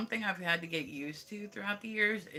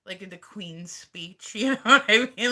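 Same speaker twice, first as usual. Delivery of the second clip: ordinary delivery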